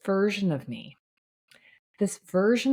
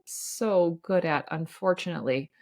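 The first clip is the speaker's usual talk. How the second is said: ordinary talk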